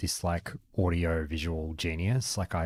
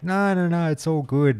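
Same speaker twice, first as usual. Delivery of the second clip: breezy cheer; 9 semitones higher, 5 dB louder than usual. Retold or imitated. Cheerful reassurance retold.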